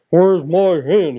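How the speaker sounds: loud, slow giant's roar; furious shout. A narrator's reading. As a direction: bellow after the stolen hen. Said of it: "he shouted".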